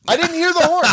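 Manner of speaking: squeaky, loud fake innocence; high innocent whine.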